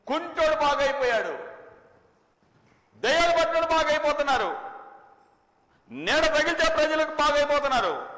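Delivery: fervent preacher's cry; preaching at full force. Acted. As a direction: thunder it out to the congregation. Preacher exclaims miracles.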